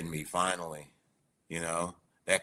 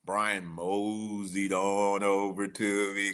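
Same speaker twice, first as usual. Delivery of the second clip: moseyed on over, stretched and unhurried; folksy slow storytelling; slow drawn-out telling; 3 dB quieter, slower than usual. Shown as a demonstration. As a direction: savour the word moseyed slowly. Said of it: "I say it like this".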